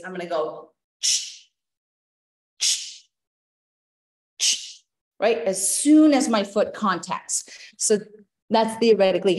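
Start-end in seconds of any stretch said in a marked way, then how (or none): 0.0-5.4 breathy hissed exhales for athletes; hissed shh cue. Shown as a demonstration. Coach shows athletes bounding, hissed exhales. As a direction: hiss each landing breath. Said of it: "let's say I'm working with athletes".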